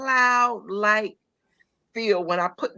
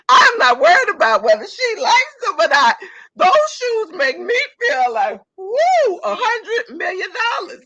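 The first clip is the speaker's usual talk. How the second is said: much higher and louder, laughing through it; giddy laughing brag, higher and louder; excited laughing shout; 11 semitones higher, 7 dB louder than usual. Real, not acted. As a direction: crow with delight about the shoes. Joyful boast about comfy shoes.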